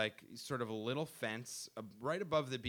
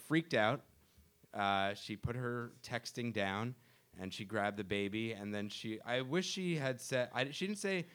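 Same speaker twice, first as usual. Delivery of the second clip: normal talking voice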